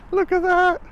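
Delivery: surprised. Real, not acted. Sudden sight on bridge.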